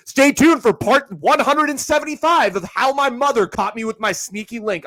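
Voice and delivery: high, loud storytime-video voice; mock influencer girl. Podcaster mocking TikTok girls, exaggerated high voice. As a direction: hype up a teaser in a mocking high voice.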